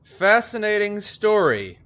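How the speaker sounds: ordinary delivery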